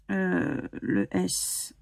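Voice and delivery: ordinary delivery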